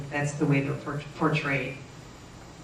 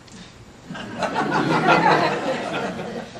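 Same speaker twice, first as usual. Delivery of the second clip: laugh; 13 semitones higher, 5 dB louder than usual. Real, not acted. Laughing at her own reply.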